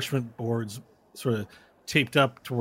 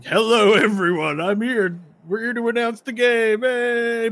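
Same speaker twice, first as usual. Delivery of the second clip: higher, louder hype-man voice; co-host announcer; 11 semitones higher, 7 dB louder, slower than usual. Acted. GM voicing the co-host over the intercom, eager hype. Co-host Truck Slayer speaking.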